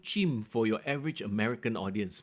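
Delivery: ordinary talk, nothing marked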